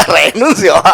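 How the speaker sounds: giving up with a laugh through renuncio. Real, not acted. Preacher losing his thread, laughing as he says renuncio. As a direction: say renuncio while laughing at himself.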